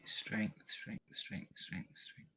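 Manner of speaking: very quiet, fading repetition under the breath; muttering to self. Real, not acted. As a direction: repeat it absently while searching. Searching for strength check, talking to self.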